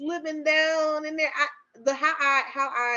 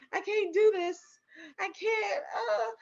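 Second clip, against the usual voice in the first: high, whimpering protest, re-enacted; overwhelmed in labor; 9 semitones higher than usual. Labouring mother telling husband she can't, high whimpering plea. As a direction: act out giving up mid-contraction. Re-enacting despair during contraction.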